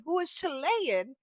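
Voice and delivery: usual speaking voice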